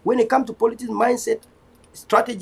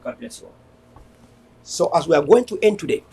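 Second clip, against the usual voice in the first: ordinary talk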